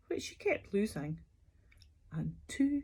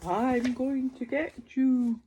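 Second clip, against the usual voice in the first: a hint of a dinosaur roar, louder; 5 semitones higher, 6 dB louder than usual. A narrator's reading. Dinosaur roars at the paper dolls, mock scary. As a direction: growl it like a playful dinosaur. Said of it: "clawed and roared and said".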